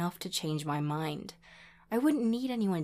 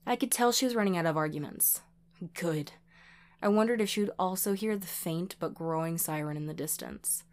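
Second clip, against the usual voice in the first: plain reading voice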